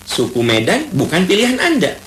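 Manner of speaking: ordinary delivery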